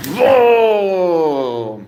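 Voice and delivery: high, drawn-out surprised whoa; real surprised whoa. Real, not acted. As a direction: react to a sudden leap. Cat suddenly jumps.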